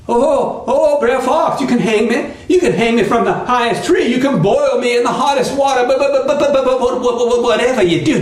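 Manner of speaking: high, stuttering begging; pleading Brer Rabbit, panicky. Acted. Rabbit begs fox to spare him, high stammering plea. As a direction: beg frantically, tripping over the first sounds.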